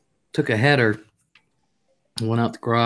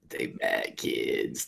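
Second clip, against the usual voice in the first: whisper; 12 dB quieter, much slower than usual. Real, not acted. Whispered mock accusation.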